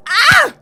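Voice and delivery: sudden high, loud yelp of pain; sharp cry. Acted. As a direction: cry out as if hit. Sudden cry mid-threat.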